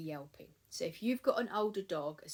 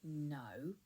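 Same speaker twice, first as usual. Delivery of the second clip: calm no; 2 semitones lower, 7 dB quieter than usual. Shown as a demonstration. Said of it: "really calmly".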